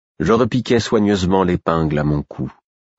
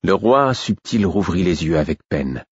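plain reading voice

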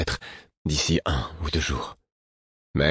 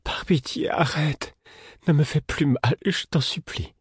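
much higher, breathless begging; pleading; 11 semitones higher than usual. A narrator's reading. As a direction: plead for the pain to stop.

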